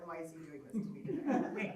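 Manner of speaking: understated, easygoing mock complaint; mild resignation. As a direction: wonder it calmly, without any cussing. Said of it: "now I'm not cussing in the car anymore".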